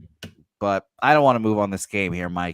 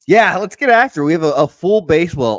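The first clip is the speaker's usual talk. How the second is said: hyped; 8 semitones higher, 10 dB louder than usual. Real, not acted. Hyped stream opener.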